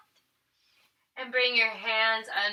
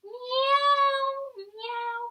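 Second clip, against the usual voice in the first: Halloween cat meow; 12 semitones higher than usual. Shown as a demonstration. Teacher yowling high like a Halloween cat for kids. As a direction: screech like a spooky cat. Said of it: "like a cat on Halloween".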